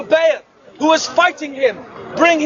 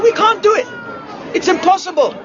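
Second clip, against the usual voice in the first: higher-pitched imitation of giving up; mock surrender; 6 semitones higher than usual. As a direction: act out exasperated defeat. Voicing defeated philosophers giving up.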